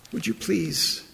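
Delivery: usual speaking voice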